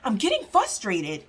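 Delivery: high, loud exasperated outburst that settles down; mock frustration. Seeker complaining to Spirit, mock frustrated and loud. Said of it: "they're like".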